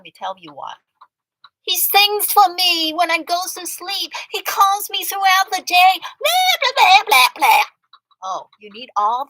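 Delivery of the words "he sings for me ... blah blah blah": high, loud mock swoon over the boyfriend; mocking the friend. Mimicking her friend bragging about the scammer, mocking. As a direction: parrot her like a lovesick fool.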